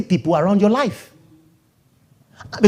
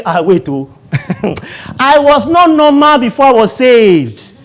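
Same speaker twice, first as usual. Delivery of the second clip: high, loud picking-a-fight voice; hammed-up threat to roll up sleeves; mock provoked believer; 8 semitones higher, 7 dB louder than usual. Believer mocked for threatening a rejecter, loud. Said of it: "You don't say".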